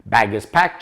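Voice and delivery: normal talking voice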